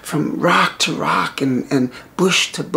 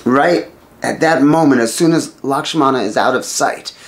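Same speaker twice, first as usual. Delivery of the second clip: ordinary delivery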